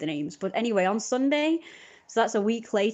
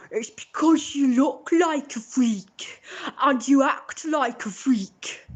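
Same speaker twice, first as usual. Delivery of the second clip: put-on high, slow, sneering boy voice, higher; sneering teen boy; 6 semitones higher, 5 dB louder, much slower than usual. Imitating the teen boy who called her a freak, slow sneer. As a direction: drag out the insult like a sneering boy.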